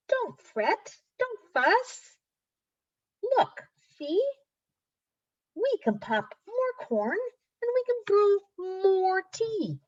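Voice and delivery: very high little mouse voice; squeaky mouse. A narrator's reading. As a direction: squeak reassurance like a tiny mouse. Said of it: "Mouse squeaks".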